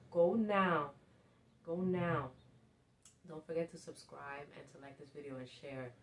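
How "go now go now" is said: loud, emphatic command to act; urgent urging. Real, not acted. Motivational speaker urging viewers to act now, loud and urgent. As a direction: push them into action, raised voice.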